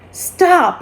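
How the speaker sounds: high, sharp cry of stop; cried out. A narrator's reading. Narrator crying out to the mean kids, high urgent call. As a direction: call out to make them quit. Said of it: "I cried".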